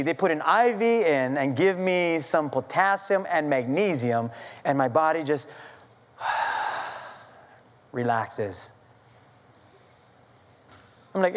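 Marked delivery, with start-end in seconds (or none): 6.2-7.0 long, soft breathy exhale; sigh of relief. Retold or imitated. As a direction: let all the tension out in a breath. Body relaxing after treatment, acted.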